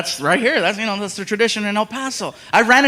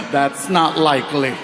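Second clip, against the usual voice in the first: low dry voice; 6 semitones lower than usual. Retold or imitated. Skeptical reply, voiced.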